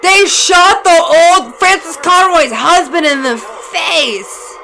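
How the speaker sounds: very high, loud, drawn-out outburst; shocked yelling. Real, not acted. Viewer reporting a shooting to co-host, shocked yelling. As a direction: yell it in disbelief.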